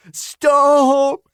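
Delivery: high, loud whiny plea; pathetic high-pitched begging. A narrator's reading. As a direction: beg in a squeaky high voice. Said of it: "he begged in a pathetic, high-pitched voice".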